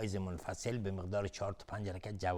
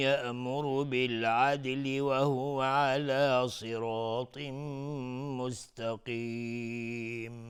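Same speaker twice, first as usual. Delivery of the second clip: slow, melodic, drawn-out chant; Quran recitation; 4 semitones higher, 4 dB louder, much slower than usual. Acted. Reciter chanting the whole verse slowly, melodic and devout. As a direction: let each phrase ring out, slow and devout.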